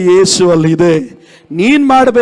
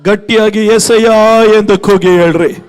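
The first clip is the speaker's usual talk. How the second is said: higher and louder, building to shouted declarations; rally the church into crying out Jesus' name; preaching at full force; 7 semitones higher, 3 dB louder than usual. Acted. (ಜೋರಾಗಿ ಕೂಗುತ್ತಾ ಏರುವ ಪ್ರವಚನ)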